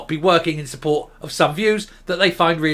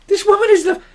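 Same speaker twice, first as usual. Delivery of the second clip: high-pitched, louder exasperation; exasperated outburst; 5 dB louder, faster than usual. Real, not acted. Commentator fed up with this woman, exasperated outburst. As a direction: burst out in a high, incredulous voice.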